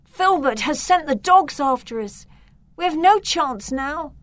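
terrified of the dogs; boy hearing the dogs coming, panicked. Acted. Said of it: "Victor panicked".

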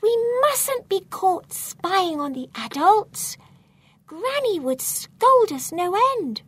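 high, forceful stage whisper; urgent whisper. A narrator's reading. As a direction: warn a friend in a loud whisper. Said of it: "I whisper to him".

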